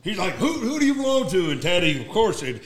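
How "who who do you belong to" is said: 1 mock gruff challenge. Retold challenge from officer.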